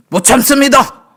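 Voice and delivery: high, explosive mock shout; exasperated protest. Congregant protesting to the pastor that they can't endure, exasperated shout. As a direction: burst out loudly as if patience has snapped.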